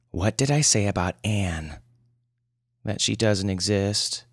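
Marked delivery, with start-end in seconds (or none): none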